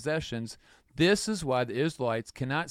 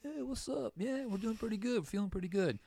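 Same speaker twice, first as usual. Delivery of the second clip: high, casual cocky voice; breezy self-satisfied reply; 10 semitones higher, 5 dB quieter than usual. Retold or imitated. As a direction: act carefree and pleased with yourselves. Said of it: "we're like".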